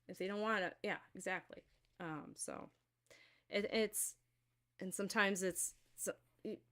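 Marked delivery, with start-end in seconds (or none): none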